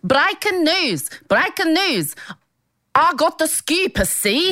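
put-on high, slow old-time American newsreader; old newsreel accent. Asked to do the accent, announcing news like a newsie. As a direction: sell the headline like a 1940s newsie. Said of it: "Can you do it in the accent?".

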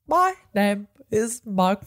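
slow, halting Cockney attempt; badly attempted Cockney impression; Michael Caine. Shown as a demonstration. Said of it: "It's so bad".

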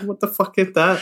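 high-pitched incredulous reaction; mock disbelief. Retold reaction to fake arm.

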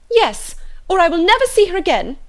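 high, loud outburst; indignant cry, pitched far up; outraged ultimatum. A narrator's reading. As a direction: explode with a mother's threat.

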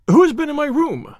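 high, loud frantic question; a man mad with panic crying out, loud and high; panicked cry. Acted. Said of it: "he cried".

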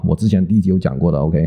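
ordinary delivery

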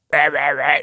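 mock gibberish from someone heckling; unintelligible heckler. Man in the queue heckling the storyteller, mock unintelligible jabber. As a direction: imitate words you couldn't make out. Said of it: "Jeg hører ikke, hvad han siger".

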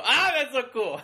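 usual speaking voice

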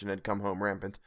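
plain reading voice

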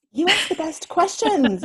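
high and bright, ask stressed; delighted compliment. Real, not acted. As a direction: gush with delight.

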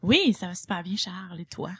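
sultry voice. Acted. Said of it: "Elle a comme pris une voix sensuelle".

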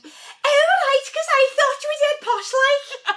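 very high, loud mock Scouse squawk; imitating an excited Scouse woman, loud and high-pitched; posh voice slipping into Scouse. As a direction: drop the posh act into broad, shrieking Liverpudlian. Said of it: "Her voice went".

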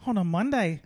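mock Australian complaint; Australian accent. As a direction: finish it in an Aussie accent. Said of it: "That's not a bad Australian".

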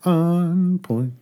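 lilting sing-song. Pretend. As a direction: sing it out playfully. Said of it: "I'll take your sing-song".

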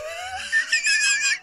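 high burst of laughter. Real, not acted. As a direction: shriek with laughter. Big laugh after robot voice.

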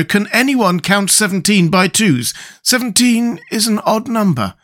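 high, puzzled storybook voice; doubting Scarecrow. Acted. Scarecrow doubts the directions on the pills, puzzled. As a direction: question it in a high voice.

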